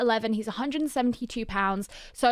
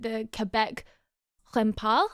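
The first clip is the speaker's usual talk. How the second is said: tentative attempt at a French accent. Shown as a demonstration. Host trying the team's name as it's meant, French accent. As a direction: try the French pronunciation, unsure. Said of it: "meant to be said in a French accent".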